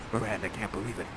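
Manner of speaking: usual speaking voice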